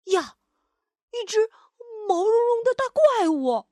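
high, wide-eyed gasp of alarm; startled discovery. Acted. Louise spots a big furry monster under the bed, startled. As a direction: draw out the fluffy part in high astonishment.

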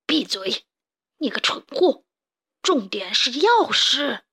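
villain boss rebuking a fool; stern, irritated reprimand; harsh scolding. Acted. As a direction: cut him down, then drag out the point. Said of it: "普老大狠狠地训斥道".

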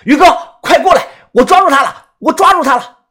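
loud, high urgent yell; frantic high shouting while grappling; angry shout. A narrator's reading. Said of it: "扭头怒声地大喊".

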